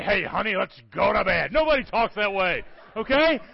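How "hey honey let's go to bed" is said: mock preacher wooing his wife; loud, put-on pulpit voice for bedtime talk. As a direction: say it like a pompous pastor at home. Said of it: "talk to your wife that way".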